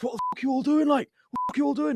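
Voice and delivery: high, furious yelling; angry yell. Retold or imitated. Boss yelling at the lads on site, high furious. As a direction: tear into the lads.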